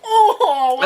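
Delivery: high laughing squeal of shock. Retold or imitated. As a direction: squeal it out in disbelief. Reacting shocked in retelling.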